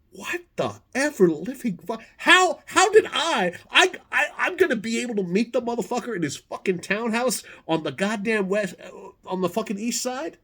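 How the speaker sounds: high-pitched incredulous sputter; retold astonishment, voice jumping high; stunned disbelief. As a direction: stammer upward in astonishment. Retelling his stunned reaction to invitation.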